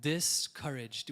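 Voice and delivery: drawn out and falling; slow repeated word for the congregation; slow, weighty emphasis. Shown as a demonstration. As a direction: let the word sink in slowly. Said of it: "this is another word".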